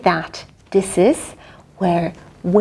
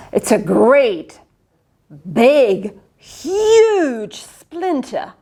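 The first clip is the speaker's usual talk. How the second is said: boasting; much slower than usual. Acted. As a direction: drag out huge to brag. Boasting of splinter.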